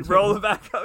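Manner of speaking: hammed-up callback with a laugh; quoting the comic through laughter.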